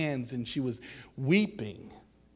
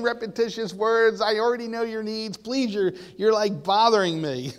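higher, louder impatient scolding; worked-up, insistent rebuke in a raised pitch; exasperated God; 6 semitones higher, 5 dB louder than usual. Retold or imitated. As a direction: voice God fed up with being pestered. Voicing God tired of repetitious prayers.

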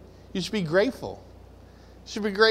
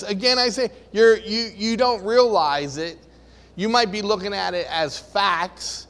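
ordinary talk

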